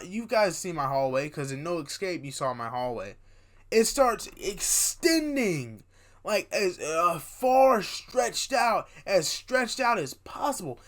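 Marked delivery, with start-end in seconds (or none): 3.7-10.8 long drawn-out, raised storytelling voice; excited, elongated delivery; stretched-out words. Acted. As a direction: stretch the key words like the hallway. Drags words describing stretching hallway.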